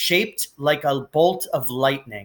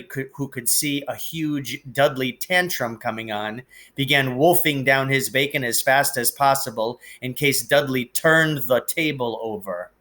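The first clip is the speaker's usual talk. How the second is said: ordinary delivery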